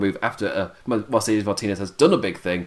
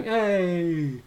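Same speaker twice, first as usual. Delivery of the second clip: mock little yapper; 6 semitones higher, 4 dB quieter than usual. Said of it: "the smaller person next to them going".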